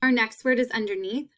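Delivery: ordinary delivery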